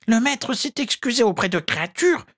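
very high, squeaky indignation; high-pitched scandalised house-elf; indignant elf. A narrator's reading. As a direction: protest in a tiny, high creature voice. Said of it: "s'indigna l'elfe".

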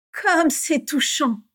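high, drawn-out sarcasm; mocking. A narrator's reading. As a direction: jeer with exaggerated sweetness, very slowly. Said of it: "railla Bellatrix". (raillerie traînante)

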